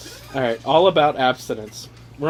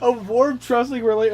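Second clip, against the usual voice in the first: higher, sing-song mock of the phrase; sarcastic higher reread of the guide; 7 semitones higher than usual. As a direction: repeat it like it's ridiculous. Mocking read of guide phrase.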